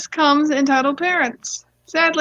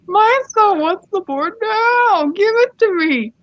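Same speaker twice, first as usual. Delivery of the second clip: entitled mom; 9 semitones higher than usual. Acted. Entitled mom demands board for her son, high pushy voice. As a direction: play a hammed-up overbearing mother. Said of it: "here comes the voice actors".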